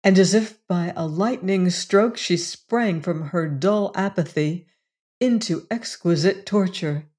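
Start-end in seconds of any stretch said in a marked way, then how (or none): none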